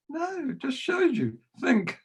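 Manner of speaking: high, pointed teacherly no; high mock reproof. Choirmaster gently reproving the cheeky boy, high and pointed. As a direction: tease him with a lesson.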